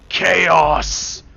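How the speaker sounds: "Chaos!" yelled loud. Retold or imitated. Man imagines yelling "Chaos!" at a kindergarten, loud. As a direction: bark "Chaos!" out. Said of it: "and yell".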